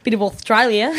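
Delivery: put-on playful Australian accent through a laugh; jokey Aussie accent. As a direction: put on a light-hearted Australian twang. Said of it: "quite like the Australian accent".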